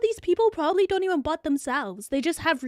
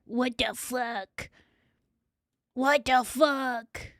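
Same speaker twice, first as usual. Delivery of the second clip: slow disbelief; 4 semitones lower, 4 dB quieter, much slower than usual. Real, not acted. Bewildered at chat joke.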